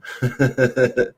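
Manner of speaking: high rippling laugh; light high-pitched giggle; chuckle. Real, not acted. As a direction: laugh at your own remark in quick high pulses. Laughs at own description.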